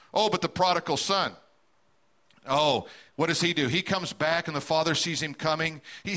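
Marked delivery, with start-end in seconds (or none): none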